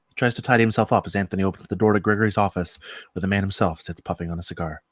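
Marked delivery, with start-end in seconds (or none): none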